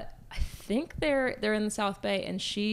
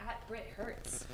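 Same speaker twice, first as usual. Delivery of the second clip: whispered; 18 dB quieter, slower than usual. Real, not acted. Whispered handle of a friend.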